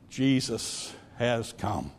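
soft, low and slow; tender reveal. Retold or imitated. Little girl answering the grouchy nurse, tender reveal. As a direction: let the good news land gently.

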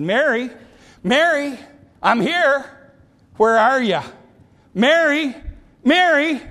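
high, searching calls; Jesus calling out. Acted. Jesus walking Bethany looking for Mary, calling out. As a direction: call a name down the street. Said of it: "calling for Mary".

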